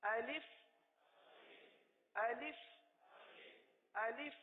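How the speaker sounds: الف as a crisp "a-lif" with short vowels; short, even "alif" for pupils to repeat; correct alif pronunciation. Shown as a demonstration. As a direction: model الف with single L and short "i". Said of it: "الف کو اس طرح پڑھیں گے".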